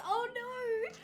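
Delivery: amused shriek of dismay; high squeal of dismay. Real, not acted. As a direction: squeal it out in fake alarm. Reacting to chat's dog story.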